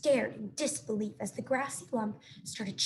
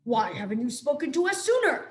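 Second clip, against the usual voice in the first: screech; 2 semitones higher, 5 dB louder than usual. A narrator's reading. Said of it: "screeched the owl".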